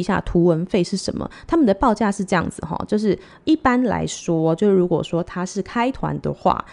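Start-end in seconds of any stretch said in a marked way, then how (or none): none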